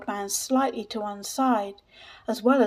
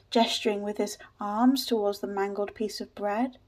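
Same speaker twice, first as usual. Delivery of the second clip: plain reading voice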